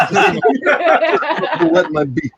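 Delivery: drawn out slowly, high and laughing; slow giggly mobster voice; laughing Godfather line. Retold or imitated. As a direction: crack up while doing the mob boss. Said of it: "it's like the Godfather".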